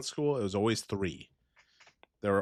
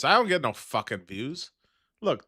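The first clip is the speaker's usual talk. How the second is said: usual speaking voice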